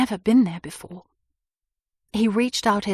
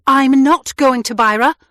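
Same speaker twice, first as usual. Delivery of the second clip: higher, loud defiance; interrupting; 5 semitones higher, 8 dB louder than usual. A narrator's reading. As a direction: cut him off firmly. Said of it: "I interrupted".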